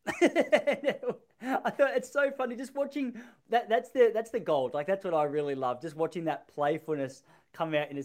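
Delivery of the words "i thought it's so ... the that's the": high, amused laughing delivery. Real, not acted. Interviewer recalling a comedian's audience chat, laughing. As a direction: talk through real laughter. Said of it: "laughing 'cause I".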